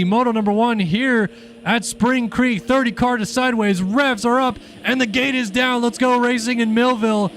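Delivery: high, loud race-call voice; play-by-play. Acted. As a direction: call the race live and hyped.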